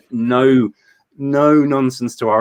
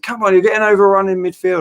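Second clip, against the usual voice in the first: high, loud complaint from the stands; raised-pitch mock terrace moan; heckling fan; 8 semitones higher, 5 dB louder than usual. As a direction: imitate an exasperated football heckler.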